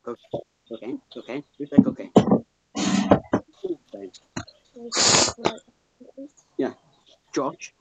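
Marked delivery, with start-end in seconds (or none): 2.1-4.1 breathy whispered word. Acted. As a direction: hiss it as a whisper. Whispered sound effect.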